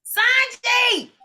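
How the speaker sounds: loud high call of a name; sing-song shout of Sanji. Real, not acted. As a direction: call her name out across the room. Host calling out to a participant.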